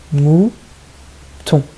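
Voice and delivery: "Mouton" slowly, a gap between its two syllables; split into syllables. Shown as a demonstration. Teacher repeating the example for children, "Mouton" syllable by syllable. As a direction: stretch "Mou", pause, then "ton". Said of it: "Deux syllabes".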